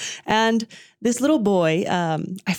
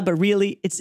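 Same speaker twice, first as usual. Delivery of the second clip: ordinary talk, nothing marked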